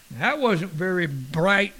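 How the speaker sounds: usual speaking voice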